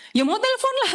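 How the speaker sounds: high, defensive snap back; mock irritated answer, voice pushed up; exasperated retort. Answering her nagging boyfriend, mock exasperated high retort.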